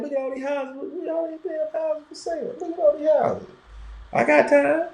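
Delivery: high, hushed amazement at the houses; mock gawking. Host mimics riding past houses, gawking in hushed awe. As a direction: gawk at every house like a tourist.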